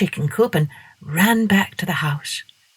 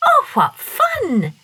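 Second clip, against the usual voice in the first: much higher, slow excited squeal; delighted girl; 13 semitones higher, 3 dB louder, slower than usual. A narrator's reading. Masha delighted by the dog's invitation, high.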